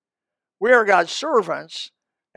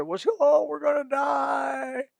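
retold panic, shouted high and drawn out slowly; mock panicked shout; 7 semitones higher, 4 dB quieter, much slower than usual. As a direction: act the frightened disciples crying out. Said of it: "They said".

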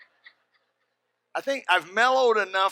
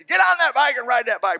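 loud, higher yelled order; angry command; 8 semitones higher, 9 dB louder than usual. Retold or imitated. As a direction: order him onto the bike. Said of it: "And, and then".

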